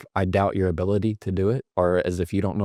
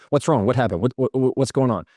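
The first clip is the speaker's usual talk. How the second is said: higher, rapid-fire stammered questions; grasping for control; 4 semitones higher, faster than usual. Shown as a demonstration. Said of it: "you're grasping for control".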